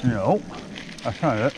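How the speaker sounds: talking around a flashlight held in the mouth. Real, not acted. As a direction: mouth propped open by a flashlight. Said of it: "I'm holding a flashlight in my mouth".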